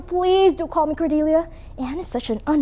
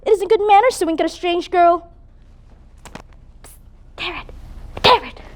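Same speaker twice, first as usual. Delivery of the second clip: ordinary talk, nothing marked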